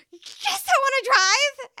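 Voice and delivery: high, loud eager shout; excited yell. Retold or imitated. Paramedic answering her partner, an excited loud yes. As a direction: jump at the chance, loud and high.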